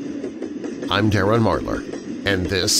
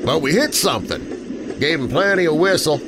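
higher, uneasy working man's voice; gruff train engineer; 8 semitones higher than usual. Acted. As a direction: shrug it off but with the willies. Train engineer answers his mate.

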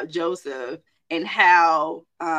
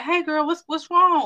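higher, worried friend asking what's wrong; concerned friend; 9 semitones higher, 5 dB louder than usual. Retold or imitated. Friend calling back a crying mentee, worried and higher. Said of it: "I'm like".

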